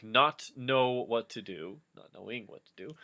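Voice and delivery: ordinary delivery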